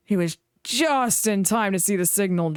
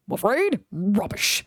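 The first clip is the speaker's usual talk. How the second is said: huffy; 2 semitones higher, slower than usual. A narrator's reading. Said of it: "said Gordon huffily".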